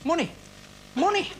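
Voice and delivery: high gleeful cry of money; delighted squeal. Acted.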